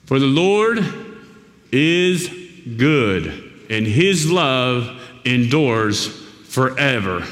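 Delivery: slow, deliberate reading with long pauses; scripture reading. Acted. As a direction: let each phrase of the psalm settle. Resumes reading the psalm.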